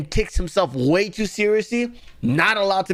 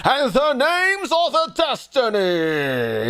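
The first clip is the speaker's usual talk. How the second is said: put-on higher, slow, theatrical old-English reading; mock Shakespeare mode; 7 semitones higher, much slower than usual. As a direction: ham it up like a Shakespearean actor. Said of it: "switches to William Shakespeare mode and goes".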